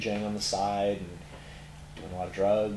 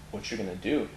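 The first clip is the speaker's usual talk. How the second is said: normal talking voice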